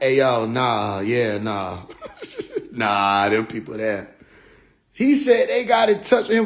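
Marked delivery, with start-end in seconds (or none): 0.0-4.1 low laughing alien voice; low and amused, shrugging it off; mock alien. Aliens hearing the chanting, low amused voice. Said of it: "were like".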